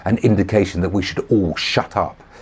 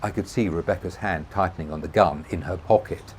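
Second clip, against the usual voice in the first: usual speaking voice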